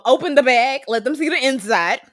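hammed-up order to open the bag; playful mock instruction; mock serious command. Imitating Tay showing his bag.

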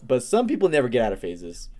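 usual speaking voice

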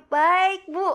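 very high, loud polite reply, acted out; basa-basi membalas sapaan. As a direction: sing out a neighborly small-talk answer. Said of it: "biasa basa basi kan". (jawaban basa-basi bernada tinggi dan lantang)

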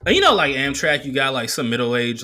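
normal talking voice

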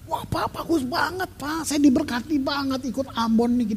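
light, high-pitched fan voice; imitated gushing admirer. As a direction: mimic a church member gushing quietly. Mimicking gushing listeners praising him.

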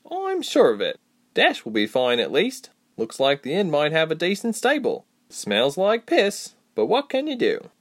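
high-pitched companion, casually resigned; the friend's high voice, making do; confident Reshma. Acted.

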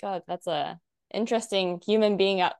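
normal talking voice

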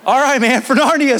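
high, laughing agreement; amused going-along. Retold or imitated. Teammates humoring the freshman, amused agreement.

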